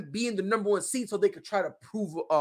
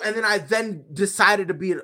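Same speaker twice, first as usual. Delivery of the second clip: normal talking voice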